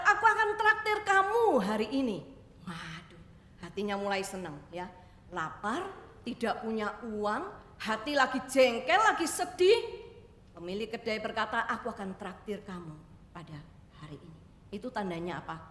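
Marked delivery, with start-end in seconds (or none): 0.0-2.2 much higher and louder, a generous exclamation; cheerful offer. Acted. Shop owner offers treat, exclaims. 11.7-14.4 softer, settling into a kind hush; gentle offer. Acted. Owner's offer repeated gently.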